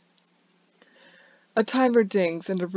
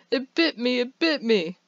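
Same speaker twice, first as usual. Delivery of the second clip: high-pitched mock scream, only a little louder; imitated scream; 6 semitones higher, 3 dB louder than usual. Barista imitating the bitten customer, mock screaming. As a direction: put on a high voice, half-screaming. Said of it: "She was screaming".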